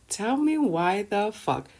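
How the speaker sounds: slow, high, drawn-out "tell me why"; exasperated "tell me why the fuck", dragged out; incredulous demand. Real, not acted.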